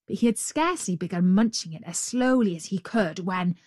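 ordinary delivery, nothing marked

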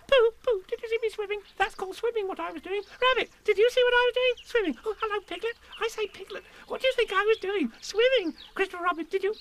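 very high eager chatter; excited little Roo. Acted. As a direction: bounce from friend to friend. Roo boasting to everyone.

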